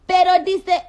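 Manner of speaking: put-on loud, high-pitched Spanish accent. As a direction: burst out in an exaggerated Spanish accent. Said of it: "Do the Spanish accent".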